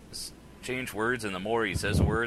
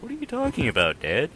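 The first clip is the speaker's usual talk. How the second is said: much higher, disbelieving question; incredulous retort; 7 semitones higher, 5 dB louder than usual. Retold or imitated. To dad complaining about Pittsburgh, a much higher incredulous question.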